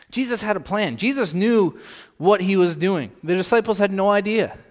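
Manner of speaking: loud, high preaching burst; preaching at full force. Acted. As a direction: proclaim it to the congregation.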